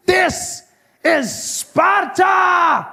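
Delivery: slow, high, shouted battle cry; movie hero shout. Pretend. Comic quoting a movie line, shouted slow. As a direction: bellow it like a movie king. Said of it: "relive my favorite moments".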